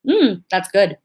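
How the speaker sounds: flat, polite "Mm, that's good" with no enthusiasm; unimpressed. Shown as a demonstration. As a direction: say "that's good" as if it's merely okay. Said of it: "Just the way I said it".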